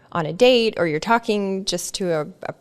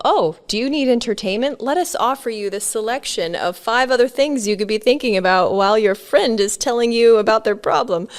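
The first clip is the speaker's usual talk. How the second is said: higher, louder salesy mind voice; mind as salesman; 4 semitones higher, 4 dB louder than usual. Retold or imitated. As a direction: pitch distractions like a waiter. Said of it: "my mind will go".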